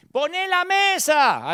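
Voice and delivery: high, slow parent's call; calling out to a child. Retold or imitated. Parent asking the son to set the table, high drawn-out call.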